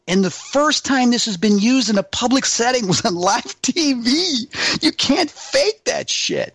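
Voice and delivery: on a roll, carried away with excitement; real excitement. Real, not acted. Guest proud of the first live TV use, rising excitement. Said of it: "when you're on a roll and you're excited".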